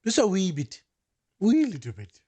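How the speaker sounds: a lightly put-on, bad Irish lilt; joking co-host tries an Irish accent, poorly. Jokey bad Irish accent. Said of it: "That was the worst Irish accent".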